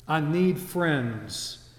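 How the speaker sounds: slow plea. Acted.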